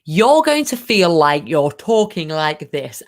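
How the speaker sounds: slow, exaggerated diction on every word; very slow, hyper-clear enunciation; over-articulated. Shown as a demonstration. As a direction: spell out each word with overdone clarity. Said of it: "as clear as you can".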